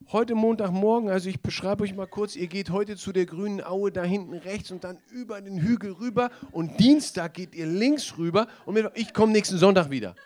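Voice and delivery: loud, high, hammed-up briefing; mock shepherd instructions. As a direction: parody a pedantic shepherd giving directions. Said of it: "der Hirte kommt nicht zu den Schafen und sagt".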